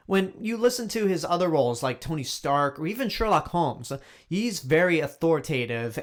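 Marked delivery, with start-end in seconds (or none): none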